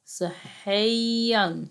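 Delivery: صحيًا slowly, lingering on the y sound; drawn-out yaa. Shown as a demonstration. Teacher hunting madd yaa for pupils, drawn-out sound. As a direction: stretch the middle of صحيًا. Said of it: "مد ياء صحيًا".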